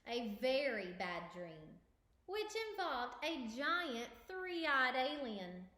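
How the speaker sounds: lively storybook narration; reading to children. Acted. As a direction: read it aloud for kids. Reads picture book aloud.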